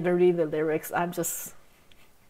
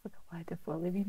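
whisper; 2 semitones lower, 11 dB quieter, much faster than usual. Real, not acted. Quiet aside while watching.

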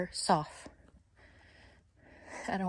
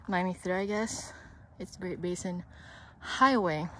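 out of breath; 2 semitones lower, 7 dB quieter than usual. Real, not acted. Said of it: "I'm running out of breath".

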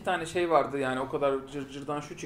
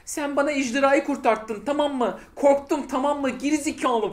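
higher, louder, slow scolding; mock angry Clara; 8 semitones higher, 6 dB louder, slower than usual. Fan reenacting Clara yelling at the Doctor, mock angry. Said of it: "falan diye kızıyor ya böyle Clara".

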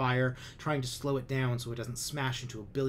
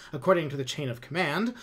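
higher, louder blustering excuse; pompous commander; 5 semitones higher, 6 dB louder than usual. A narrator's reading. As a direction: cover the awkward truth with bluster. Lum justifies his command.

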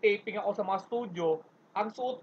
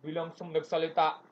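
ordinary delivery

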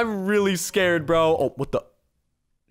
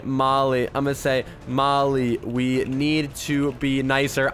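slow, lower, word by word; dictating while typing; 6 semitones lower, slower than usual. Real, not acted. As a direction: say it as you type it out. Types chat message aloud.

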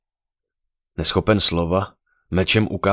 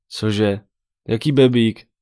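raised, baffled questioning; puzzled, higher-pitched double question; uncomprehending; 5 semitones higher, 5 dB louder, slower than usual. A narrator's reading. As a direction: ask loudly, not getting it at all. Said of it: "zeptala se nechápavě".

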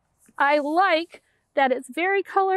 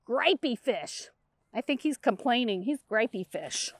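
much softer, low complaining grumble; gripey grumble; 4 semitones lower, 7 dB quieter than usual. Acted. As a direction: sulk like a grumpy fish. Voicing a complaining fish.

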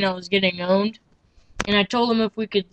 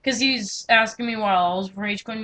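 usual speaking voice

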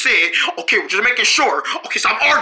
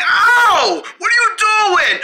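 high, loud yelp of protest; pained outcry; 12 semitones higher, 3 dB louder, much slower than usual. Acted. Patient protests having his head pushed down, pained outcry. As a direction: cry out in pain and indignation.

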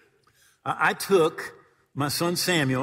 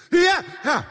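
eager yes; 9 dB louder than usual. Retold or imitated. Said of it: "Have your life jackets".

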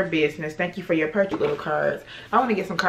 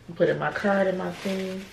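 ordinary delivery

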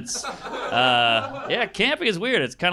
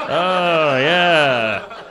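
forced reassurance; 7 dB louder, much slower than usual. Retold or imitated. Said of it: "they all have to be like".

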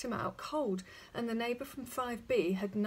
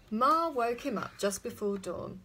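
ordinary delivery

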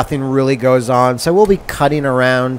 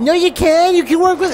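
high pleading; 14 semitones higher than usual. Acted. Pleading with game wife.

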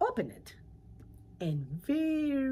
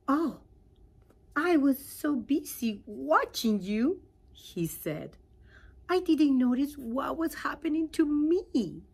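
higher, louder, amazed; surprised frog voice; 7 semitones higher, 9 dB louder than usual. Acted. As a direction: realize with a start you've changed. Frog voiced in read story.

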